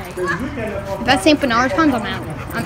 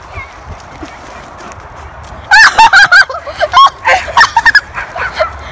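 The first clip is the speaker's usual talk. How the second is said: high burst of laughter; 4 dB louder than usual. Real, not acted. Laughing at the dog jumping.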